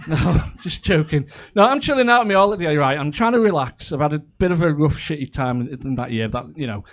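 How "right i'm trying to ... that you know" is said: normal talking voice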